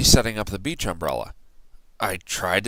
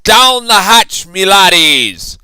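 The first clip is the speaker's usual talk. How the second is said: much higher, loud slow toast; pirate yell; 10 semitones higher, 11 dB louder, slower than usual. A narrator's reading. Braidbeard toasting his crew at dinner, loud yell. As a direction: bellow the toast at the table. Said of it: "pounded his fist on the table and yelled".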